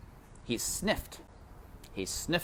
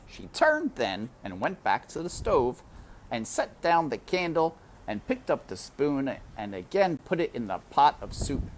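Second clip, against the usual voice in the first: ordinary delivery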